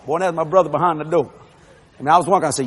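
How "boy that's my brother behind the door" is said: plain reading voice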